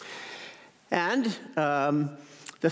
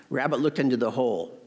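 ordinary delivery

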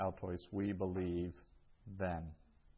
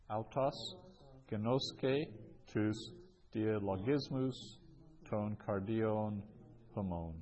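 word-by-word reading. Shown as a demonstration. Teacher repeats the fourth example for learners, word by word. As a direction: separate each Greek word for the students.

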